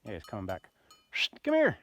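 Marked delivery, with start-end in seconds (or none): 1.4-1.8 high, loud call across the field; calling to a horse. Real, not acted. Rider calling a horse across the field, loud high call. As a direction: call the horse over from a distance.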